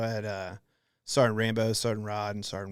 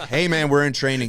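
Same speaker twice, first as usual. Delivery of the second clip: firmer; 4 semitones higher, 10 dB louder than usual. Retold or imitated. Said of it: "I was just like".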